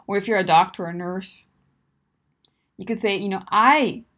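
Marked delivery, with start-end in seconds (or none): 3.5-4.0 loud, emphatic "I"; stress on I. Shown as a demonstration. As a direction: punch the word "I". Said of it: "You're always gonna use "I".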